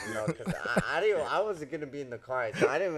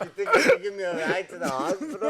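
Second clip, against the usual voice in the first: fast, higher and louder, laughing through it; laughing quote; 7 semitones higher, 7 dB louder, faster than usual. Retold or imitated. Boxer laughing while quoting the jaw-broken opponent asking for a ride. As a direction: race through the request, cracking up.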